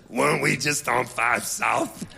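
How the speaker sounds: low, slow mild puzzlement. Retold or imitated. Storyteller voicing [name] noticing the loop.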